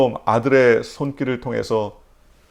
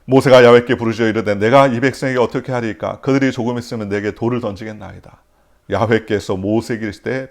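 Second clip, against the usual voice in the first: louder, solemn reading voice; scripture reading; 5 dB louder than usual. Acted. Pastor reading Exodus to the congregation, solemn and loud. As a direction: proclaim the Bible text.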